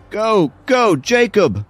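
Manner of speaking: high, loud cheering shout. A narrator's reading. Girl cheers for Jacob to spite her rival, shouting. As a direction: shout him on from the sidelines. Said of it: "she shouted".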